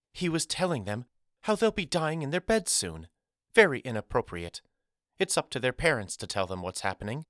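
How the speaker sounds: a touch indignant, high at first, then brisk; upset schoolteacher. A narrator's reading. Miss Carrington complaining about Jeremiah scaring pupils, upset. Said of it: "Miss Carrington's voice still carried that quiver".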